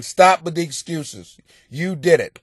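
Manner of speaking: normal talking voice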